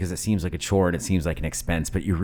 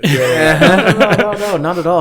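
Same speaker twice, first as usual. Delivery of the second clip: laughing; 12 semitones higher, 10 dB louder, slower than usual. Real, not acted. Laughing denial of mulling.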